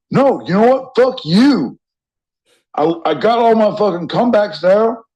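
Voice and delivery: high, hammed-up telling-off; mock angry ex. Podcaster imitating an angry ex on the phone, mock fury. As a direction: play the furious ex with a comeback, high and hammy. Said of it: "like a fucking angry ex".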